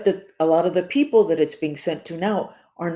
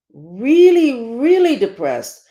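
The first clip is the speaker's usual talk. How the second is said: "really, really" pushed higher and louder, slowed; emphatic really; 7 semitones higher, 7 dB louder, much slower than usual. Retold or imitated. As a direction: lean hard on both "really". Pressing the wife, retold.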